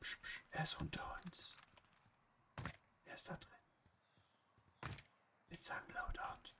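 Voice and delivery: hushed unvoiced whisper. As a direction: whisper so the enemy can't hear. Flüstert, Gegner unter ihnen. (geflüstert, ganz leise)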